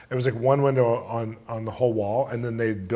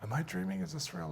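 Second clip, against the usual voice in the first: hushed, higher-pitched wondering; dazed disbelief; 4 semitones higher, 13 dB quieter than usual. Retold or imitated. Sleepy man doubting what he sees, hushed disbelief. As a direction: murmur it softly to yourself. Dazed thought on seeing witch doctor.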